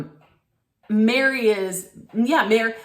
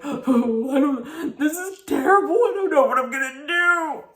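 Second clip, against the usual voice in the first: high, hammed-up weepy wail with gasps; mocking a housewife's sobbing confessional; 6 semitones higher than usual. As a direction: parody her tearful meltdown, gasping between lines. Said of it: "sobbing in the camera".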